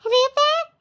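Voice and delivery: high sneering squeak of the word; squeaky mock of a grinning man; mocking grin. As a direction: mimic his grinning sneer. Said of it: "ಇವ್ನ್ ಕಿಸ್ಕೊಂಡಿನಿ".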